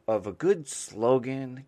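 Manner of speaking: ordinary delivery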